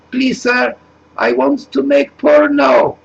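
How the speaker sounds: very high, timid pleading voice; coy young woman. Acted. Migrant woman asks Farage to let her in, mock coy. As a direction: ask sweetly and shyly.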